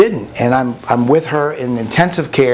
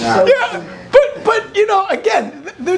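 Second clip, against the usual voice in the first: laughing; slower than usual. Real, not acted. Laughing after rant.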